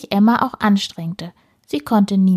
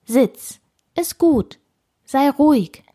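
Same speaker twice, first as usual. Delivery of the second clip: short, higher-pitched order; energetic command; 6 semitones higher, slower than usual. A narrator's reading. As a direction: tell a dog firmly to settle. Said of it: "Zu Herrn Schröder sagte sie energisch".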